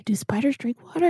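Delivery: fast unvoiced whisper; hushed quick bedside question. Whisper at sickbed.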